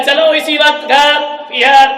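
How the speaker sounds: loud, high, slow insistence; stubborn bride shouting command; angry demand. Acted. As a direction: order him home right now. Daughter demands leaving.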